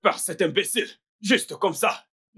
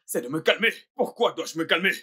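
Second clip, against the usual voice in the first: normal talking voice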